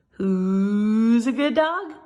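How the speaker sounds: slow, sing-song good-dog voice; slow doggy self-praise. Acted. Arfie answering his own question, slow and proud.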